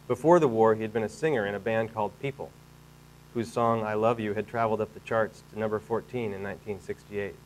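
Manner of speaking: projected, measured literary reading; story narration. A narrator's reading. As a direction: read the story out to an audience. Reads book chapter aloud to audience.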